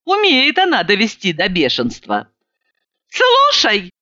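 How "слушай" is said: very high-pitched outburst; exasperated yell. A narrator's reading. Sober woman to her drunk friend, yelling in exasperation. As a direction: cry out, driven to fury. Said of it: "завопила я".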